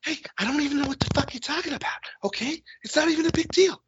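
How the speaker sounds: high, fast, defensive babble; Mark Wahlberg impression. Mocking a defensive movie star, high fast jittery voice. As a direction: brush off the accusation in a jittery rush. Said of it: "that Mark Wahlberg guy".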